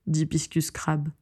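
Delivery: plain reading voice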